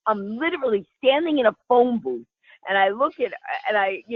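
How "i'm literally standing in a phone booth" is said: ordinary talk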